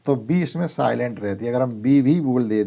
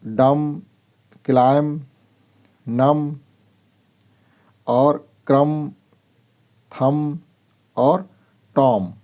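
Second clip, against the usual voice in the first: drill word list; 2 semitones higher, 3 dB louder, much slower than usual. Shown as a demonstration. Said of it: "इन्होंने अभ्यास करवाया".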